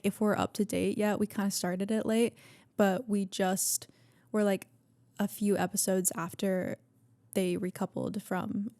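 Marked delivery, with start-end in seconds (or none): none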